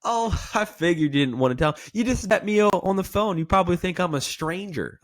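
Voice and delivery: laughing, high tongue-in-cheek; playful. Shown as a demonstration. Agent disarming the wary prospect, laughing and playful. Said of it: "selecting a playful tonality".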